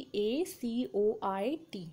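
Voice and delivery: teacher spelling out the word dacoit for students; spelling aloud. Shown as a demonstration. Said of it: "उसकी spelling".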